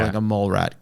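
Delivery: ordinary talk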